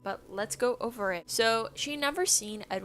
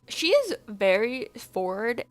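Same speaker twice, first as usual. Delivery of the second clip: ordinary delivery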